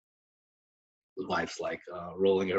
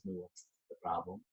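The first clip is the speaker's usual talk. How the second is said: calm Rebbe; 5 semitones lower, 13 dB quieter than usual. Retold or imitated. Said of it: "the Rebbe says".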